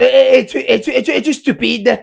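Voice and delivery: loud, high, stammering outrage; furious quarrelling. Acted. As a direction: burst into an indignant tirade. Heated quarrel with teacher. (tirade furieuse, forte, aiguë et bégayante)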